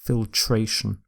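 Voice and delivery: FILTRATION without the E, fil-TRAY-shun; correct pronunciation. Shown as a demonstration. Teacher repeating the corrected word, FILTRATION as fil-TRAY-shun. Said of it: "in filter is dropped".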